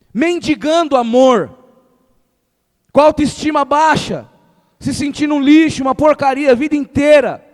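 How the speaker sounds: loud, rising sermon climax; fiery preaching. Acted. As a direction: preach it hard, building to a roar. Preaching at full force.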